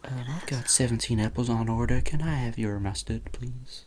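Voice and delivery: hushed, playful ASMR whisper. Pretend. Podcaster spoofing ASMR at the wrap-up, whispering. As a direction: whisper nonsense softly into the mic.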